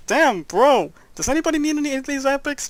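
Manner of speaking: loud, fast, high excitement; excited. Real, not acted. Gamer thrilled by epic drops, loud fast excitement. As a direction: burst out over the loot.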